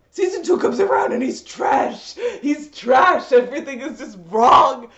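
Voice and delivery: loud, slow, disgusted declaration; angry rant. Real, not acted. Fan ranting about Suzaku, loud and disgusted. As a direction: spit out the verdict on him. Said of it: "ranting about the villain".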